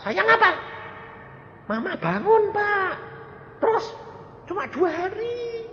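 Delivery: very high, pleading complaint; imitating the son. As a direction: whine on the phone about the short time. Son's disappointed call retold. (menirukan anak yang mengeluh, suara sangat tinggi)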